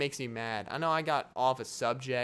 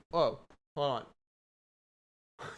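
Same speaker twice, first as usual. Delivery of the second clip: ordinary talk, nothing marked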